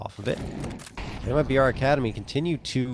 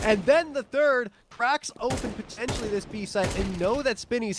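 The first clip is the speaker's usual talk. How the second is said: hyped, much higher play-by-play; esports caster; 12 semitones higher than usual. Acted. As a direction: ride the climax of the round. Caster hypes round climax.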